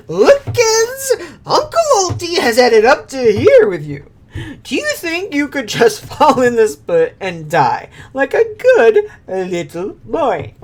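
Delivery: high, loud gleeful taunting; gloating octopus villain. Acted. Octopus villain gloating at the heroes, high and loud. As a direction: cackle it at the kids.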